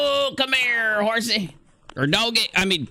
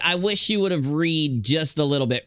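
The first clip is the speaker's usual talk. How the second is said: ordinary delivery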